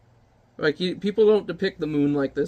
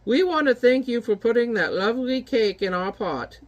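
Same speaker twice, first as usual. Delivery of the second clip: witch voice; 6 semitones higher than usual. Acted. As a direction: savour the stench like a cackling witch. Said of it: "witches thank giant".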